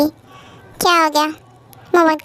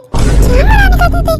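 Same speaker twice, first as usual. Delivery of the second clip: much higher and louder outburst; loud protest; 13 semitones higher, 7 dB louder than usual. Acted. Urged by her friend to kiss him, she shouts back.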